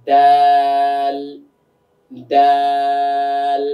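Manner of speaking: দাল drawn out very slowly, tongue tip on palate; slow drawn demo. Shown as a demonstration. Teacher showing students how to draw out দাল, very slow. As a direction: stretch দাল long and steady. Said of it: "তাহলে আমরা কিভাবে বলবো?".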